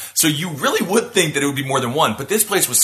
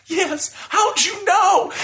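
teary birthday woman; much slower than usual. Retold or imitated. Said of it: "she almost cried because she's like".

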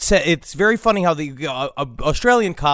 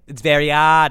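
dropped r's in put-on Australian; lightly comic Australian accent; Australian accent attempt; 3 semitones lower than usual. Shown as a demonstration. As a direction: try an Australian lilt. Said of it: "how to do the Australian accent".

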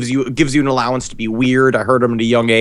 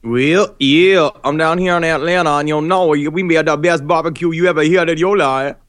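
higher-pitched put-on Atlanta twang; hammed-up Atlanta local bragging; mock Atlanta accent; 4 semitones higher than usual. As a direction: play up a silly Atlanta accent. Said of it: "What accent is that?".

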